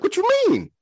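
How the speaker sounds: high, shocked "what you mean"; baffled squeak. Retold or imitated. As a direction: jump way up in a confused high squeal. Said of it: "And I'm like".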